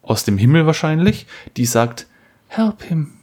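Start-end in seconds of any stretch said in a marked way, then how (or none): 2.5-3.1 high, pleading voice from above; wife's voice from heaven. Retold or imitated. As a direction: plead gently like an angel. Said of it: "die Stimme von deiner Frau, aus dem Himmel".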